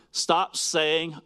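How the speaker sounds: normal talking voice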